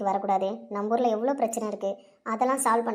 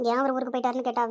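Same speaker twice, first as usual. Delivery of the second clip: normal talking voice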